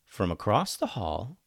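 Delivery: plain reading voice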